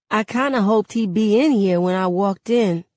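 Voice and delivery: plain reading voice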